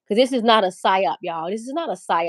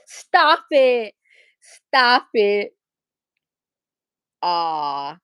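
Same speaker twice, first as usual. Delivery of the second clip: loud, raised, flattered protest; playful protest; 4 dB louder, much slower than usual. Real, not acted. Streamer reacting to viewers fighting over her, playful protest. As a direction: protest loudly after a laugh.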